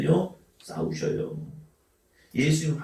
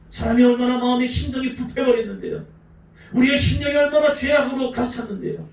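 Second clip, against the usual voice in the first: preaching at full force; 6 semitones higher than usual. Acted. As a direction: cry it out to the pews. Preaching climax.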